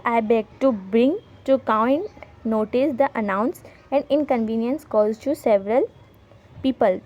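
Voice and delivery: slow, careful dictation pace; slow read-aloud. Shown as a demonstration. Teacher reading the sample letter to students, slow dictation. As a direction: read each English word out slowly for the class.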